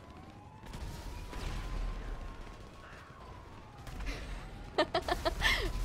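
high-pitched breathless squeal of a laugh; a giddy, disbelieving laugh breaking upward. Real, not acted. Streamer squealing with laughter at a thousand donation, high giddy laugh.

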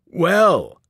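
loud, drawn-out, gloating "well". A narrator's reading. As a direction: savour it like a bully who has won. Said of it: "Joe Bob gloated".

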